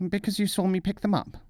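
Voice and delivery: high, whiny petulant retort. Acted. Nasruddin sulking at the dervish, high petulant whine. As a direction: whine it back like a sore loser. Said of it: "Nasruddin was petulant".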